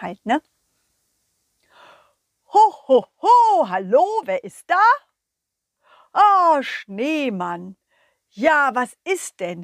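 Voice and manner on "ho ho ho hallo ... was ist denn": loud jolly Santa voice. Acted. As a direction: boom out a hearty ho ho. Santa answers phone.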